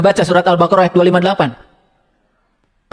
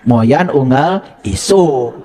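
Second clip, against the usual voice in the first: slow repeat; 5 semitones lower, much slower than usual. Shown as a demonstration. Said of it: "itu M U I namanya".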